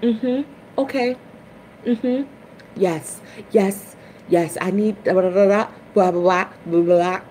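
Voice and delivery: mock her own answers to the bank; lightly voiced self on the phone; imitating herself on phone. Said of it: "And I'm like".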